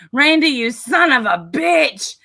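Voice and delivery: high, drawn-out mock scolding; imitated chewing-out. Retelling Shannon chewing out Randy, high drawn-out mock anger. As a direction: act out being cussed out, stretching it high. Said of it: "Getting chewed out hurts".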